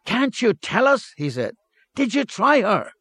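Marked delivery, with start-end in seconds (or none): 0.1-1.1 high, pressing menace; menacing. A narrator's reading. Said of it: "a note of menace pierced through his voice". 1.9-2.9 high, pressing menace; menacing. A narrator's reading. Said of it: "a note of menace pierced through his voice".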